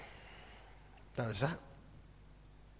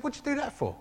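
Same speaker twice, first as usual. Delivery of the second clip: higher-pitched mock wife voice; wife's exasperation; 12 semitones higher than usual. Karen asks why he came home soaked, high exasperated wife. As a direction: play the baffled wife, pitched up.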